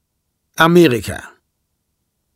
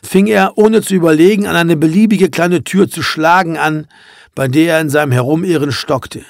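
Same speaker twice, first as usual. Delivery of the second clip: ordinary delivery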